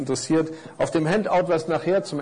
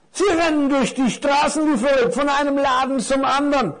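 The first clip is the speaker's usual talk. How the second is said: higher, animated storybook character; curious big parcel; 10 semitones higher, 4 dB louder than usual. Acted. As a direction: wonder aloud with lively, raised pitch. (neugieriges Päckchen, lebhaft und hoch)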